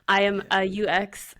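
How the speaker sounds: ordinary delivery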